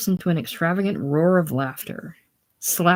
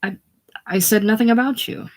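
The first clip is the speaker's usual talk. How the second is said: frightened; 6 semitones higher, 3 dB louder than usual. A narrator's reading. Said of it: "actually frightened now".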